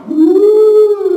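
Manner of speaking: long, high, loud "woo"; mock mystical woo. Preacher mimicking mind-reading, high spooky woo. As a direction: hold an eerie high "woo".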